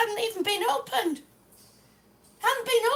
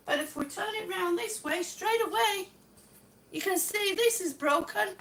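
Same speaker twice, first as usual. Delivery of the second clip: ordinary talk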